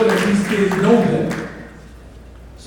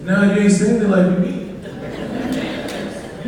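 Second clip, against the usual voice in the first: ordinary talk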